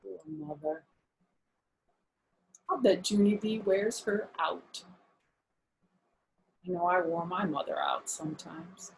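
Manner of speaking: ordinary delivery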